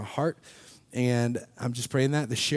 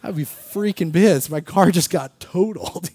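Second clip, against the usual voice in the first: hypothetical anger, higher and louder; mock outrage; 6 semitones higher, 9 dB louder than usual. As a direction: fake being furious about the wrecked car. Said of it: "If it were me, I'd be pissed".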